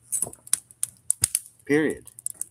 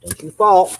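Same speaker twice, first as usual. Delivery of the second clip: loud, high sudden warning; sharp shout; 10 semitones higher, 12 dB louder than usual. Real, not acted. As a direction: yell a quick warning. Warning log on fire.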